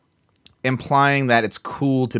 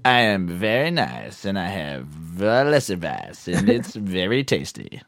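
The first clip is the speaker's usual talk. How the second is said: a light, slow, lower mock German accent; 4 semitones lower, 4 dB quieter, slower than usual. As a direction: imitate his German accent lazily, slowly. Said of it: "that was my German accent".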